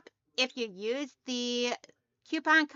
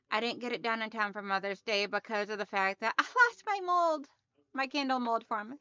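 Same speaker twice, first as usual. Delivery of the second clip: usual speaking voice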